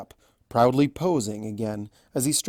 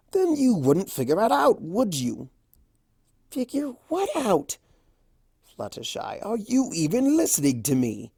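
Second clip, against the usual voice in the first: lightly voiced Discord; 8 semitones higher than usual. A narrator's reading.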